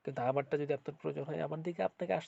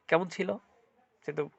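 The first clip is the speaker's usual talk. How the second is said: ordinary talk, nothing marked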